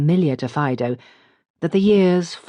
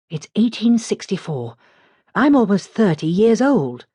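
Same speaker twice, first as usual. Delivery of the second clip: scolding herself; 6 semitones higher, 3 dB louder than usual. A narrator's reading. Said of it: "she scolds herself".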